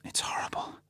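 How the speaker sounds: whispered, choked up; tearful whisper. A narrator's reading. Vesper grieving over Bond's wounds, whispered. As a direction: breathe it out through tears. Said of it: "Her eyes filled with tears".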